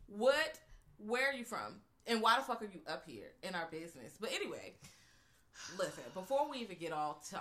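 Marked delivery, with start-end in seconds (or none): 0.1-4.2 mock confrontation. Voicing the residents confronting.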